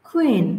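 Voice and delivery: "কুইন" as khuin, falling, with airy K; aspirated K. Shown as a demonstration. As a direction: push breath through the K of "কুইন". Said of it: "কিউর উচ্চারণটা এখানে খয়ের মতো". ("কুইন"-এ ক হবে খ-এর মতো)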